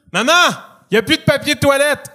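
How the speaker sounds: high yell for mum; calling out. Acted. Kid stuck on the toilet calling mother, high acted yell. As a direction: shout it to someone in another room.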